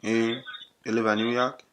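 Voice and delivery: thick Jamaican patois; put-on Jamaican accent. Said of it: "used my Jamaican accent".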